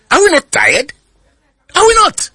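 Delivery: high, strained rhetorical cry; exasperated appeal. Real, not acted. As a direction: pitch climbing sharply on the repeat.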